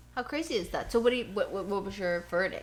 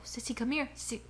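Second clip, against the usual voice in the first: whispered call to the pet; hushed voiceless dog-calling; whispering to the dog; 3 semitones higher, 7 dB quieter than usual. Retold or imitated. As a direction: whisper Sissy to come close.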